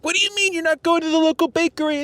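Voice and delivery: high-pitched imitation of indignant friends; mock outraged questioning. Everyone questioning his food choices, mock outrage in a high voice. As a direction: put on a high, scandalized voice.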